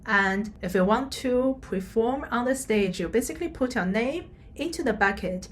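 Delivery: ordinary talk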